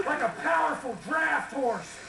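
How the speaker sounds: folksy Texas twang; Texas accent. Acted. Folksy rancher telling family stories to a crowd, Texas accent.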